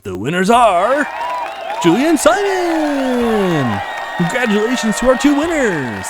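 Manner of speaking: big, slow game-show announcement; booming host proclaiming the champions; announcer reveal. Acted. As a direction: call out the winners like a TV host.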